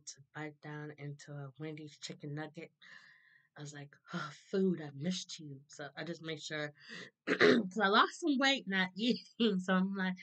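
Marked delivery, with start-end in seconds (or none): none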